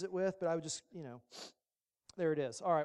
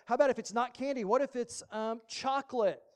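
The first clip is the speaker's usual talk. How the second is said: put-on pleading kid; 4 semitones higher, 6 dB louder than usual. Child bargaining with mom.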